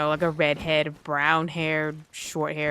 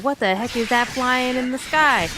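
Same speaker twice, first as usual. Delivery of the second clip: higher, mildly alarmed mock exclamation; startled onlookers; 5 semitones higher, 4 dB louder than usual. Onlookers spot something flying in the sky, mock astonishment. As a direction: imitate bewildered onlookers.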